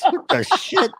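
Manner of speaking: high, laughing shock; laughing disbelief. Retold or imitated. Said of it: "I'm like".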